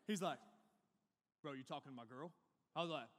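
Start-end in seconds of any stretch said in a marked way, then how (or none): 1.4-2.3 menacing bully. Retold or imitated.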